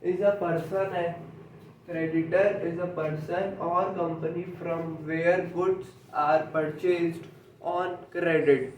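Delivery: slow, word-by-word dictation; teacher reading a definition aloud for notes, very slowly. Shown as a demonstration. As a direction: dictate each word slowly so students can write. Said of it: "dictating the definition".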